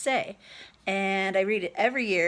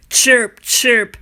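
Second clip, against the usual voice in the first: mocking chirp; 3 semitones higher, 11 dB louder than usual. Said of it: "complained the old man".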